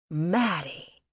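understated glad cry of a name; mild welcoming cry; mother crying out. A narrator's reading. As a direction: call out in greeting, lightly. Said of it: "she cried out".

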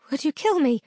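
high, frightened question; timid dare. A narrator's reading. Girl daring to ask her captor. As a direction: ask it against your fear. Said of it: "I dared to ask".

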